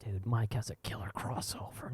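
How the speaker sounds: soft whisper; whispering regret; whispered inner thought. Retold or imitated. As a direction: murmur it as a private worry.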